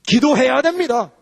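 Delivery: high, forceful pulpit declamation; fervent sermon delivery; impassioned preaching. Real, not acted. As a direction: proclaim it, stretching the key words.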